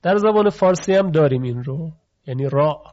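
ordinary delivery

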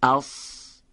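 syllables like اس and سا said slowly and crisply, with a clear hissing س; isolated syllables pronounced with care for students; careful syllable drill. Shown as a demonstration. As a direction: sound out each syllable, from اس through سن, with a clean voiceless hiss. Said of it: "دقت بفرمید".

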